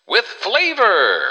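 high, slow, beaming tagline; chipper 1950s ad. Acted. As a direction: sell the last word big. Ad closing flourish.

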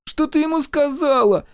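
put-on very high, loud, tearful boy; sobbing child. A narrator's reading. Микки confronts mother with blaster, sobbing. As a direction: wail it at mother. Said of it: "всхлипнул Микки".

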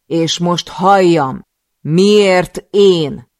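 loud, slow, high demand; slow furious demand. Acted. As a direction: drag each word out in fury. Demanding answer at knifepoint. (lassú, dühös, hangos követelés)

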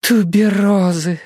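high, slow exhaled gush; breathy gasp. A narrator's reading. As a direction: breathe the word out in rapture. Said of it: "выдохнула Аделаида". (восторженный выдох)

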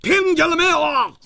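very high, furious bellow; angry roar. Acted. Hero shouting after a foe who has vanished, furious roar. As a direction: yell it as an angry demand. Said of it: "胡铁花怒吼道".

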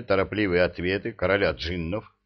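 usual speaking voice

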